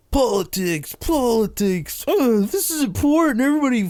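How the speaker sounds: high-pitched, exaggerated mocking voice; mock self-important posters. Comic imitates everyone on Facebook fussing over politics, high mocking voice.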